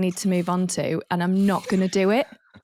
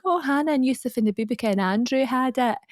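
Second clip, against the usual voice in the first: higher Scottish mum voice; imitated Scottish accent; 5 semitones higher than usual. Mum answers dad about the meat, imitated Scottish accent. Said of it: "obviously my mum's Scottish".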